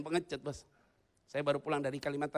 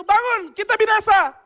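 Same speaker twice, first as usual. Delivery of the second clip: loud, high, drawn-out yell; imitated frantic disciples; panicked waking shout; 16 dB louder than usual. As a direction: shout to wake a sleeper in an emergency. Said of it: "Waktu dia dibangunkan gitu".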